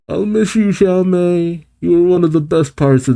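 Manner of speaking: high, tender goodbye to a character; fond farewell. Real, not acted. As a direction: sigh it out fondly, lifted high.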